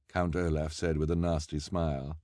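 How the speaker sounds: plain reading voice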